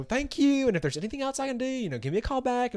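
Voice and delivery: high, sing-song Southern phone agent; Southern accent. Retold or imitated. Student aid agent ending a call, Southern accent. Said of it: "she was from the South".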